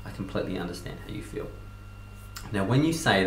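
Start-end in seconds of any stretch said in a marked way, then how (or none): 0.1-1.5 understanding, said softly and quickly, almost whispered; soft empathetic demo. Shown as a demonstration. Coach shows what to say to an angry customer, soft and calming. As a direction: murmur it gently to calm them. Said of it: "is to say".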